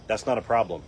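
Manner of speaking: ordinary talk, nothing marked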